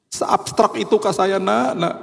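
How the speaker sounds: slow, high, plaintive question; acted pleading, pitch climbing; mock wounded wail. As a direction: stretch it out in comic self-pity. Comic hurt reaction, escalated.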